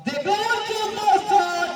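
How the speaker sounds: high, drawn-out cry on the shot; excited commentary. Real, not acted. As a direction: lift the call way up. Shot just off target.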